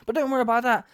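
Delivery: normal talking voice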